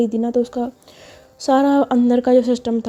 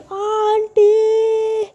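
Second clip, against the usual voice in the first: drawn-out shrieking call; shout; 11 semitones higher, much slower than usual. Acted. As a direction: scream for auntie at the top of her lungs. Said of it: "ज़ोर से चिल्लाती है".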